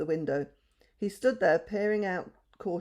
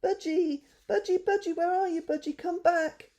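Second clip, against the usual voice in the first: put-on children shouting for the budgie outside; calling out; 12 semitones higher than usual. A narrator's reading. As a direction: call the lost bird home. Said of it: "they called".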